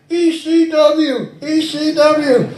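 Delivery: high, slow squeaky puppet voice; bad ventriloquist teddy bear. Terrible ventriloquist act, the teddy bear answering in a high puppet voice. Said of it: "it was the worst ventriloquist act".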